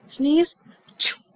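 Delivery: quick, high little achoo; mock sneeze. Shown as a demonstration. To learners, contrasting sneeze with cough, mock sneeze. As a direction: fake a small sneeze.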